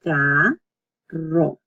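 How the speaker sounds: "carro" slowly as caa, then trilled rro; double R, drawn out. Shown as a demonstration. Teacher summing up the word, "carro" in slow syllables. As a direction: stretch and separate "carro", rolling the rr. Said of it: "it's another double R".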